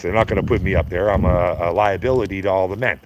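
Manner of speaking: ordinary talk, nothing marked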